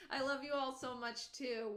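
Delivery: usual speaking voice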